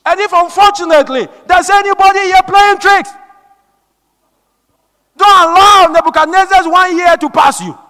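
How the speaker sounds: loud, high-pitched preaching; shouted warning. Real, not acted. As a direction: warn the congregation at full force. Preacher warns congregation at full force.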